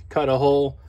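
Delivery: ordinary delivery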